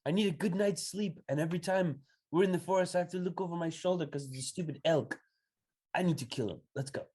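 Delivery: high, driven voice; possessed. Acted. Possessed Lucian urging the party.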